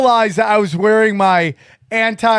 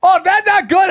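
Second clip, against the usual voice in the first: put-on high, loud Indian-accent tut; mock Indian accent, high and loud; imitated Indian accent; 9 semitones higher, 4 dB louder than usual. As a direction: scold as the shop lady. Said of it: "Indian accent".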